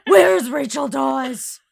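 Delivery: slow, loud growled Batman impression. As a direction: bark it like the Dark Knight. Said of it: "That's from Batman".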